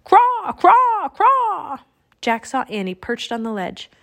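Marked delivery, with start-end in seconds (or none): 0.0-1.8 high, loud raven caws; three long, piercing bird cries; mad alarm cawing. A narrator's reading. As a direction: caw wildly like alarmed sentries. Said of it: "cawing madly in alarm".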